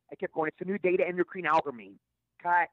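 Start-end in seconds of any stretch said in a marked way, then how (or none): 0.5-2.0 "endocrine" said instead of "encryption"; flubbed line. Retold or imitated. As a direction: stumble into "endocrine" in place of "encryption". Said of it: "I kept screwing up that line".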